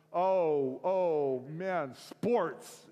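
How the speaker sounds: loud, flustered dodge; awkward panic. Acted. Guy to his crying friend, awkward panic. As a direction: fumble loudly for a way out.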